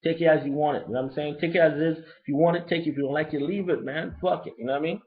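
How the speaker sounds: ordinary delivery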